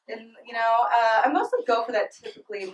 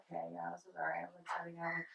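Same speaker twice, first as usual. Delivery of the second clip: much softer, detached murmur; bored inner voice; 5 semitones lower, 21 dB quieter, faster than usual. Retold or imitated. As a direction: say it like you've checked out. Bored inner thought during sex.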